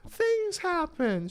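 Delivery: put-on very high, slow old-lady voice; elderly lady. Elderly customer excusing the dropped sandwich, forgiving. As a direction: imitate a sweet forgiving old woman. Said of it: "it was an elderly lady".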